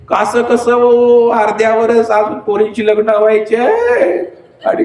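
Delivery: high, singsong mock keening; theatrical lament with stretched phrase endings; wife's lament. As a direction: wail like a bereaved wife, hammed up. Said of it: "बरंच काय काय ओरडायला लागली".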